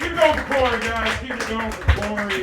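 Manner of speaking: rally the crowd's cheers; crowd hype. Real, not acted. Comic to the crowd, cheering for Corey, loud.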